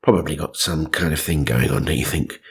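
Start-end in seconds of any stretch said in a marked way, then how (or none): none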